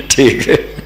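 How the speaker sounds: light laugh into ठीक है; amused little laugh. Real, not acted. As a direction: chuckle off the point. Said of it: "laugh heard".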